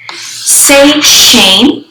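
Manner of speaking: ordinary delivery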